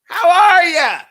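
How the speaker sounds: fake cheerful greeting. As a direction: ham up the polite small talk. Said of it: "I was like".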